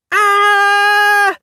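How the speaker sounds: put-on high piercing kid's scream; child's scream. A narrator's reading. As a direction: shriek like a startled little kid. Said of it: "the kid screamed".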